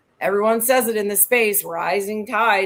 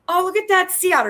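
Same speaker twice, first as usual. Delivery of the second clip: delighted exclaim; 9 semitones higher, 5 dB louder, faster than usual. Retold or imitated. Retells spotting an otter.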